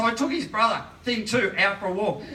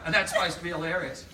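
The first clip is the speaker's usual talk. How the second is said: ordinary talk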